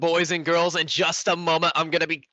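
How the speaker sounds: loud, high announcer voice; big hype intro to the crowd. Real, not acted. Host opening a broadcast.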